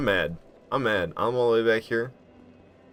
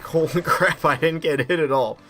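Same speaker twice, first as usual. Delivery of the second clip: louder and higher, genuinely amazed; 7 semitones higher, 6 dB louder than usual. Real, not acted. Gamer surprised he dodged everything, loud amazed outburst. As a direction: blurt it out in happy disbelief.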